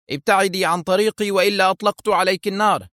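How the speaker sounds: high-pitched threat without real volume; threatening cry. A narrator's reading. Officer pretending to threaten his partner, crying out. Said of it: "ثم صاح في وجه منى متظاهرا بتهديدها".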